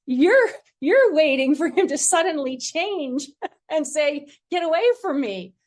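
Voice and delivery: high, loud retelling breaking into laughter; amused incredulity. Retold or imitated. Spiritual director naming directee's fear of rejection, amused incredulity. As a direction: imitate someone incredulous, laughing through it.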